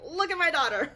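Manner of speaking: proud parents. Retold or imitated. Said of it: "They're like".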